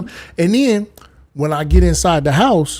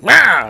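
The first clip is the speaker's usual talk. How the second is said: loud high crow squawk imitation; crow caw; 7 semitones higher, 9 dB louder than usual. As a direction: caw at him like an angry crow. Crow caws at him each morning.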